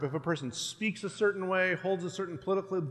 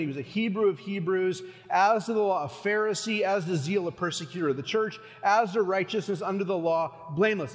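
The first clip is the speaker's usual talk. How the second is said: normal talking voice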